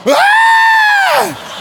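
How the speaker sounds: a high shriek of surprise, laughing; startled scream. Retold or imitated. Preacher sees a hand go up, shrieks.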